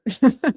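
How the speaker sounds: laugh. Real, not acted. Laughs at own joke.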